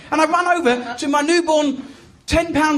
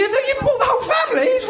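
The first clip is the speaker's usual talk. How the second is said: higher-pitched comic astonishment; mock amazement; 9 semitones higher than usual. Real, not acted. Comedian teasing a large family in audience, amazed. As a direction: exclaim it, pitch jumping up at the crowd.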